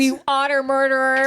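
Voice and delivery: laughing mock accusation; laughing tease. Real, not acted.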